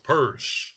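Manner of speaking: purse with an r-coloured "er" vowel; correct pronunciation. Shown as a demonstration. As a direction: say purse like the handbag, deliberately. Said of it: "And it's pronounced".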